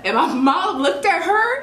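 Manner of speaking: ordinary delivery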